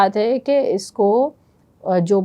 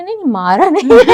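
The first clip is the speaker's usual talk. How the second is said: laughing protest; 7 semitones higher, 7 dB louder, faster than usual. Retold or imitated. Retold panicked reply, laughing.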